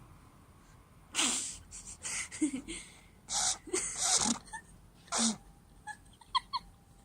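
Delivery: airy breathless laughter; long wheezing laugh. Real, not acted. Long laugh after joke.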